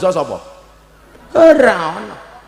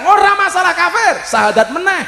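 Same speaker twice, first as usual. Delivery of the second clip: retort; 10 semitones higher than usual. Retold or imitated. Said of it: "Saya bilang".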